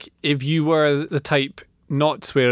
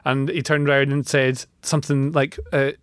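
normal talking voice

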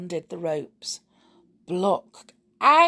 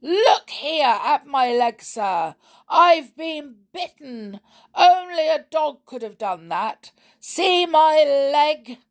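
high, loud, slow indignant complaint; raging; 9 semitones higher, 7 dB louder than usual. A narrator's reading. Bitten man showing his leg to sir, raging. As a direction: protest furiously, stretching the words. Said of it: "he raged".

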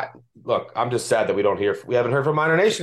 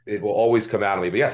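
usual speaking voice

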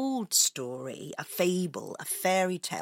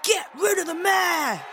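high-pitched mob shout; crowd shouting; 10 semitones higher, 4 dB louder than usual. A narrator's reading. Townspeople demanding the mayor's removal, shouting. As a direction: answer the rival chant at a higher pitch. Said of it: "others were shouting".

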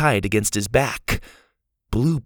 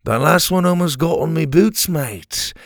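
higher-pitched, understated character threat; mildly threatening; 6 semitones higher than usual. A narrator's reading. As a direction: warn him off, a touch of menace. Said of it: "he said threateningly".

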